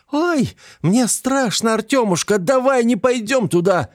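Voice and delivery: high, slow, squeaking with fright; squeaky frightened voice. A narrator's reading. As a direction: squeak it out, scared stiff. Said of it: "она снова пискнула".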